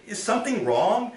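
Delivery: wife checking on husband; high wife's voice, lightly concerned. Acted.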